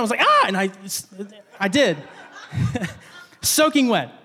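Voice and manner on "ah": sudden loud high cry; startled yelp. Retold or imitated. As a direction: re-enact a jump-scare yelp. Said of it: "she turned the lights on".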